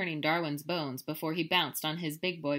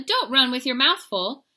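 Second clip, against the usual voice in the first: loud, high holler of Mama's; 7 semitones higher, 11 dB louder than usual. Acted. Mama hollering after her muddy kitty boy, loud. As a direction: call out across the yard. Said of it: "hollered Mama".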